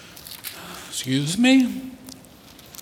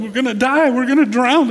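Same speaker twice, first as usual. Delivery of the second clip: higher-pitched mock panic of frightened disciples; acted panic; 7 semitones higher, 4 dB louder, faster than usual. Preacher voicing disciples in the sinking boat, panicking. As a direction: cry it out as if about to drown. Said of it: "start panicking and freaking out".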